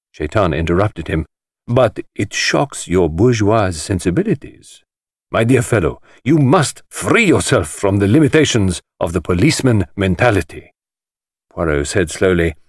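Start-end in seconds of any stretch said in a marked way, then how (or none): 1.7-10.7 high, showy voice of a flamboyant collector; theatrical host. Acted. The host lecturing the detective, grand and high-pitched. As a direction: relish every word like a showman.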